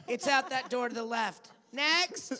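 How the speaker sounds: host loudly shooing a fan away; loud brusque brush-off. Acted. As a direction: bark out the directions and wave them on.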